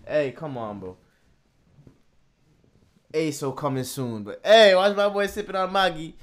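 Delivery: ordinary talk, nothing marked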